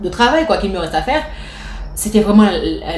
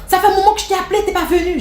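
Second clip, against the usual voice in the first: annoyed boss; 9 semitones higher, faster than usual. Retold or imitated. Furious manager reproaches her.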